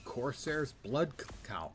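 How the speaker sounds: normal talking voice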